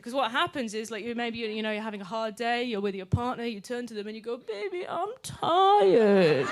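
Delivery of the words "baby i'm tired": put-on high, squeaky baby voice; high little-child whimper, laughing through it; baby talk. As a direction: whine it like a toddler wanting comfort. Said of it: "when baby talk starts".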